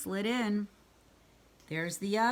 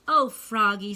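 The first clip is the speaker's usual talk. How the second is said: touched; 9 dB louder than usual. A narrator's reading. Mother touched by birthday gift.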